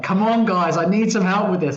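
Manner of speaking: replaying the frantic call for help; retold panic. Messaging friends for a name, mock panic. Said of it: "in an absolute panic".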